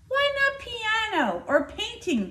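high, pleading parents' plea; pleading parents. A narrator's reading. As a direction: beg her like worried parents. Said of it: "they pleaded".

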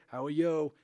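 a hint of a De Niro voice placed up high. Shown as a demonstration. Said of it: "Some guys are gonna do up here".

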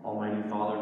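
ordinary delivery

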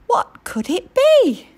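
wondering. A narrator's reading. Narrator wonders aloud, high.

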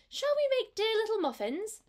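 high, bright little kitten asking. A narrator's reading. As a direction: squeak it like an eager kitten. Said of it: "said Mittens to Moppet".

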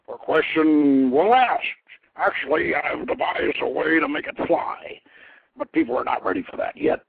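lightly voiced mighty craftsman god, pleased; god Kothor voiced. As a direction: speak as a proud inventor god.

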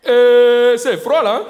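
put-on very slow, loud, high African accent. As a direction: drag it out in a mock African accent. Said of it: "l'accent africain".

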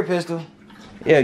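ordinary delivery

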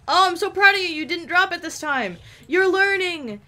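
high, loud, gushing coo; over-the-top proud praise, pitched high. Pretend. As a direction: cheer on a beginner in a high voice. Gushing praise to game character.

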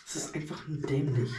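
usual speaking voice

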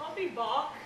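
bad Terminator accent. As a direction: mumble "I'll be Bach" in a weak Austrian Terminator accent. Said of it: "That was a horrible accent".

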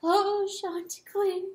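high, slow wail; weeping cry. A narrator's reading. Collapsed hen sobbing for the rooster, weeping cry. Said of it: "Pertolote cried".

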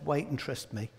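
quiet reassuring voice of God; gentle refusal. Pretend. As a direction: let them down kindly. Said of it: "Often God says".

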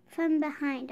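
plain reading voice

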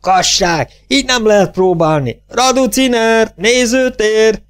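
high, hysterical theatrical yell; frantic high-pitched outcry; hysterical shriek. A narrator's reading. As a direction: wail the orders high, stretching the last names. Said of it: "Hisztérikus felsüvöltés".

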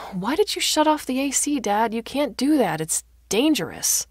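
loud, high-pitched telling-off; alarmed scolding. Acted. Daughter finds Dad shut off the A C, alarmed scolding.

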